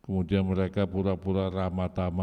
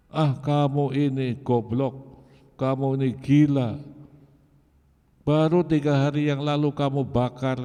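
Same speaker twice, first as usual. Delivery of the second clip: loud, raised furious rebuke; angry scolding; 6 semitones higher, 5 dB louder than usual. Retold or imitated. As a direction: tear into him for betraying the family. Father scolds son over conversion.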